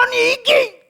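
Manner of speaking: high, loud burst of sudden temper; mock angry outburst. Preacher acting out a man who suddenly explodes in anger. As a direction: snap out like a hothead losing it.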